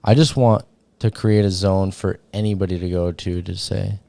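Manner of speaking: ordinary talk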